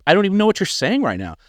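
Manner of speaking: mock-bewildered pushback; retold protest. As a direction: protest it, pitch jumping up. Retold protest to pastor's accusation.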